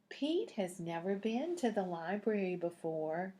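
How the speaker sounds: usual speaking voice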